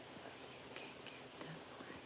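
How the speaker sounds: unvoiced whispered aside. Real, not acted. Telling a friend how to get money, whispered aside. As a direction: breathe it as a secret aside.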